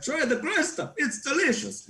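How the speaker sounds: very high-pitched storybook heroine voice; sing-song falsetto, sweetly offering a dish; Belle. Retold or imitated. Performer recalling her part in Beauty and the Beast, high mock-heroine voice. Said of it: "like, Belle would ... It was like".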